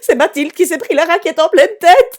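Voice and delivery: high, loud, nearly crying rant; loud distressed telling. Real, not acted. Mother telling the audience about the tennis disaster, loud and distressed. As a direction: tell the disaster near tears, loud.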